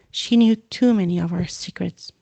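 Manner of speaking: usual speaking voice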